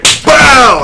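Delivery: high, explosive loud burst; exclaimed impact. Retold or imitated. Storyteller acting out his punch, explosive shout. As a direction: fire it out like a landing punch.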